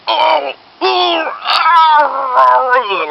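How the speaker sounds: high, drawn-out agonised groans; mock howls of pain. Prankster's stooge grabbing his stomach on the subway, howling in pain. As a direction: howl as if your stomach is killing you. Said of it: "like screaming and howling in pain".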